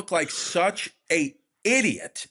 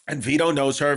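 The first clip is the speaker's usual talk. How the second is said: ordinary delivery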